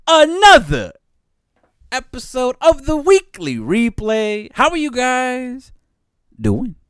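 high, loud show announcer. Acted. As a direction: announce the episode like a host.

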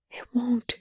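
high, breathy whisper. A narrator's reading. Perry contradicting Rush, desperate whisper. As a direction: whisper it in despair. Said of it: "she whispers".

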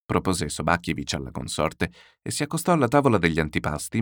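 ordinary delivery

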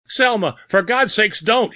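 very high, panicked plea; gasping terror. A narrator's reading. Bearded man pleading with an unseen attacker, gasping terror. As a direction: beg desperately for mercy. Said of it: "he gasped".

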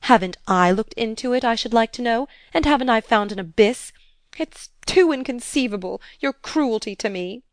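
high, loud, pleading indignation; wild outburst. A narrator's reading. Stepmother protesting her partner's cruelty, wildly indignant. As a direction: break out in wounded protest. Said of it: "she wildly broke out".

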